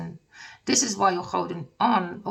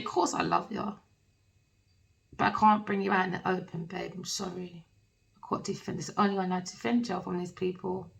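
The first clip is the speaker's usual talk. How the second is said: soft apology; 2 semitones lower, 8 dB quieter than usual. Acted. Lover voiced apologising softly.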